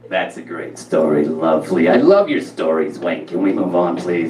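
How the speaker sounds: low, flatlined mom voice; imitating a deadpan, Harvey Fierstein-like mother; Rona. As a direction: deliver praise with no feeling whatsoever. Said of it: "this is how she talked".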